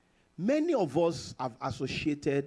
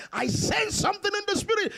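mock excited pastor; 13 semitones higher than usual.

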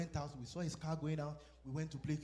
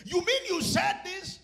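loud, slow, high accusing shout; mock shout; 12 semitones higher, 10 dB louder, slower than usual. Husband yelling at his wife in a mock quarrel, high and loud. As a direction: throw the question back at her, loud.

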